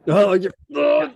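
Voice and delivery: loud, high sputtering curse; blustering challenge; hammed-up fury; mock outrage. NPC furious at losing ground.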